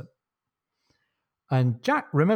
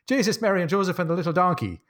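high-pitched comic Irish exclamation; Irish accent; 7 semitones higher than usual. Acted. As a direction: exclaim it with a hammed-up Irish lilt. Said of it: "do it in the Irish accent".

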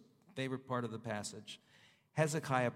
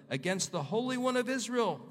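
high, loud and indignant; thundering divine rebuke; 9 semitones higher, 4 dB louder than usual. Acted. Preacher voicing God against the king, in a high, loud rebuke. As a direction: proclaim it like God calling out the proud king.

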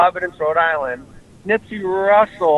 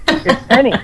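mock sitcom reveal; 8 semitones higher than usual. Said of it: "identify their gender and go".

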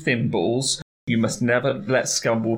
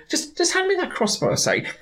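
high, breezy wizard voice; high casual voice. Retold or imitated. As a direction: toss it off lightly, high. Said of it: "Cutwell's just going".

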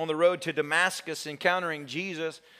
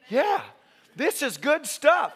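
high, slow approving audience voice; high-pitched, drawn-out approval; impressed audience member; 6 semitones higher, slower than usual. Retold or imitated. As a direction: act out a listener taken in, pitched up.

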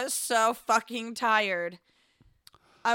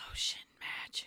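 whispered imitation; 11 dB quieter than usual. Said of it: "It goes".

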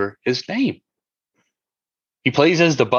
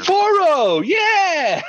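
excited shout; 3 dB louder, much slower than usual. Real, not acted. Finally remembering the actor's name.

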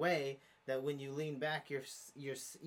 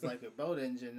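ordinary talk, nothing marked

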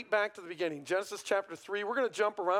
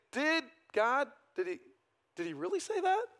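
mock serpent taunt; 7 semitones higher than usual. Preacher acts out serpent's taunt.

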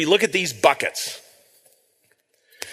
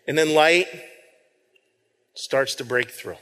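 hushed dramatic storytelling; 5 semitones lower than usual. Acted. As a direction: paint the scene slowly, letting each pause hang. Dramatic slow storytelling of the roof.

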